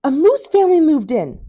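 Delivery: high, loud breathless announcement; alarmed child. A narrator's reading. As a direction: burst in with the scary news. Franklin tells mother, alarmed.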